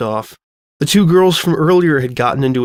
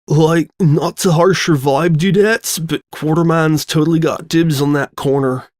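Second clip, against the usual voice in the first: stoner calling out; 3 semitones higher than usual. Acted. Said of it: "calling out to them".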